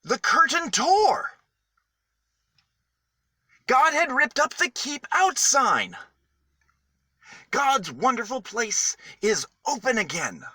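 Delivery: high, loud joyful announcement; excited proclamation. A narrator's reading. Storyteller to children, announcing the torn curtain excitedly. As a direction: burst out with the wonderful news.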